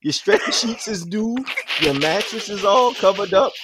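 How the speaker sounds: high-pitched New Orleans mom; mock New Orleans mama, pitched well up; New Orleans accent. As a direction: imitate a New Orleans mother pointing things out. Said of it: "In her New Orleans accent".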